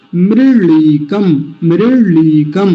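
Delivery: मृळीकम slow, with a retroflex ळ; careful recitation. Shown as a demonstration. Teacher sounding out the word for students. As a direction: curl the tongue back for ळ in मृळीकम. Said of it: "ल जैसा उच्चारण आएगा".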